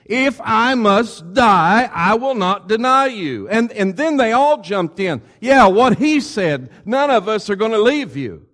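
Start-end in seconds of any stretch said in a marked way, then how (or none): 0.1-3.5 slow, high, loud insistence on dying first; emphatic vow. Acted. Peter insists to Jesus, slow, high and loud. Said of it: "and more emphatically". 5.4-8.5 eager chorus. Acted. Disciples jump in agreeing.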